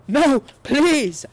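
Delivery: high, loud, desperate plea; begging. A narrator's reading. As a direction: beg for mercy. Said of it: "I begged".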